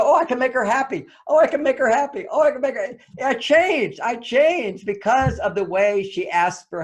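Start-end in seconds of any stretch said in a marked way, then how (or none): 0.0-3.0 eager delight. Retold or imitated. Husband walking through rooms, eager and thrilled. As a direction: say it like a man thrilled to please. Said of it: "I go".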